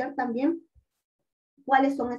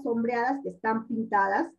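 ordinary talk

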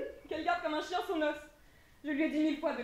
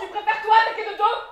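mocking jab; 8 semitones higher, 14 dB louder than usual. Mocking retort in a quarrel.

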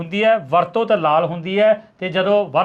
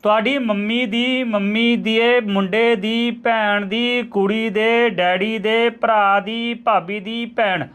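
slow, measured riddle reading; slow and careful; much slower than usual. Shown as a demonstration. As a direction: spell the riddle out slowly, word by word. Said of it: "ਹੌਲੀ ਬੋਲਾਂਗਾ". (ਹੌਲੀ ਹੌਲੀ, ਇੱਕ ਇੱਕ ਸ਼ਬਦ ਕਰਕੇ)

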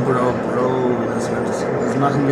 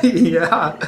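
a laughing ja, higher and louder; amused ja between laughs; laughing yes; 4 semitones higher, 4 dB louder than usual. Real, not acted. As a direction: laugh through the word. Laughs at friend's outburst.

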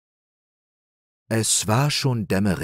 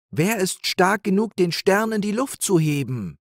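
Nepomuk's doubt; 11 semitones higher than usual. A narrator's reading. Nepomuk voiced higher.

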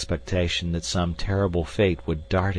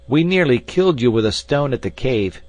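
loud, higher vengeful shout; frantic cry; 6 semitones higher, 6 dB louder than usual. A narrator's reading. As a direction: hurl the taunt across a chasm. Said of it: "more frantic than before".

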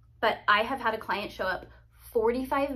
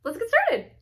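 high, loud chirpy sing-song; bright, upbeat push to get going; cheerful let's go; 12 semitones higher, 6 dB louder than usual. Acted. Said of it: "and then I'm like".

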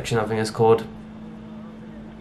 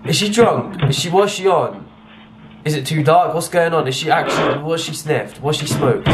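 normal talking voice

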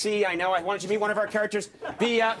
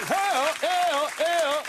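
sidekick cheer; 8 semitones higher, much slower than usual. Pretend. Host calling out a late-night cheer on the tour, hammed-up whoop.

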